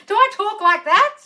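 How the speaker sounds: high, loud disbelief; incredulous question. Real, not acted. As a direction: squawk it in appalled surprise. Mock horror at being compared.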